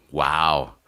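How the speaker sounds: ordinary talk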